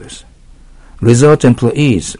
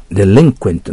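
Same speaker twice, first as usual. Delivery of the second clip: "デリンクエント" with the accent on "リン"; stress on second syllable; 4 dB louder than usual. Shown as a demonstration. Teacher correcting the accent after checking, "デリンクエント" stressed on "リン". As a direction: lean on "リン" in "デリンクエント". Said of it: "今アクセントを調べてみました".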